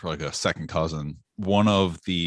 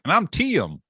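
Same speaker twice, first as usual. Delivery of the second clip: thick mountain drawl on Tim, higher; a hint of a thick mountain accent; 12 semitones higher than usual. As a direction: imitate the groom's friend's thick accent. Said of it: "He's like".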